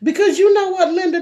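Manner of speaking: high, loud laughing lead-in; excited giggling question. Real, not acted. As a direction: laugh while calling Linda out. Laughing answer to viewer.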